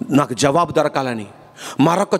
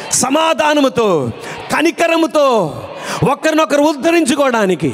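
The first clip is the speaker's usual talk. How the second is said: higher-pitched sermon exhortation; fervent preaching; 8 semitones higher than usual. Acted. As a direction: preach it in rising, insistent phrases.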